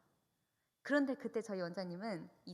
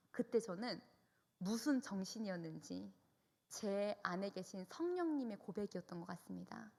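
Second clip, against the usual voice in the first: ordinary delivery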